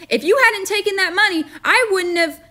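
put-on a child making excuses; arguing boy. A narrator's reading. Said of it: "boy arguing".

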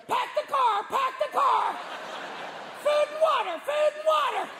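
very high squawking parrot. Acted. Parrot warning its owners of a quake, squawking. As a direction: squawk each phrase twice like a parrot. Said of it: "if your parrot starts going".

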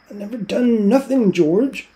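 put-on high, loud childlike protest; pleading protest. A narrator's reading. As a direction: whine it up high. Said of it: "Lenny pleading protest".

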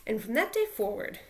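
plain reading voice

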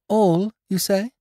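put-on high, slow, questioning girl's voice; girl speaking out. A narrator's reading. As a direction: challenge him with a drawn-out question. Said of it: "spoke out the girl".

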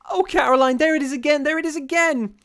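very high, loud wailing outburst; wild wail. A narrator's reading. Rebecca to Caroline as the shadow returns, wild wail. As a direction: wail it out in terror. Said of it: "burst out in a wild wail".

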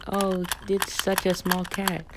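ordinary talk

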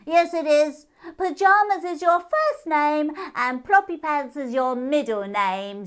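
high, loud teasing hare voice, slow on ploppy pants; cheeky moon hare. Acted. Moon hare teases P J with a high cheeky voice. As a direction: tease her with each silly name.

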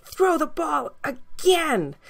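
high, slow eager puppy voice. A narrator's reading.

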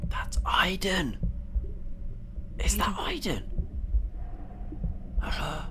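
soft, whispered disbelief; hushed whisper. Real, not acted. Player recognises Aiden on screen, hushed whisper.

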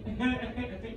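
cracking up so hard words break; giggling helplessly through the words; laughing fit. Real, not acted. Vlogger cracking up at his own camera habits.

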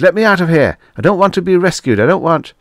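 high, loud and fast panic; panicked yelling. A narrator's reading. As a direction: yell it, scared and rushing. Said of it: "yelled Sherwood".

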